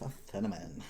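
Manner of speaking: put-on understated Valley girl voice; Valley girl accent. Shown as a demonstration. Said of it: "like one of those Valley girls".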